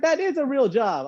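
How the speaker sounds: high, offended retort; indignant protest. Retold or imitated. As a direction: defend yourself, stung. Indignant reply to mom.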